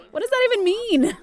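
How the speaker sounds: high, loud disbelieving squawk; incredulous outburst; baffled. Real, not acted. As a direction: shoot it up in pure disbelief. Baffled by the job title.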